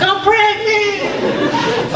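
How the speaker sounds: very high, slow, stretched wail; mock crying girlfriend. Ex calls with news, in a high drawn-out mock wail. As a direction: drag it out like a whiny sob. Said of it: "she called me crying".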